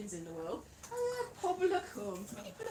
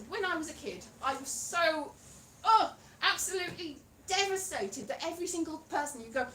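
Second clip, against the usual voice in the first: ordinary talk, nothing marked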